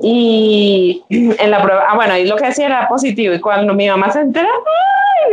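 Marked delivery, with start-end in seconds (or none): 4.5-5.3 very high delighted "ay"; mother spotting the pregnancy test, squealing; squeal of surprise. Retold or imitated. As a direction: shriek like mom just found out. Mother realizing the news.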